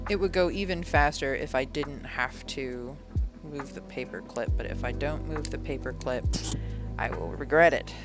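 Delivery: usual speaking voice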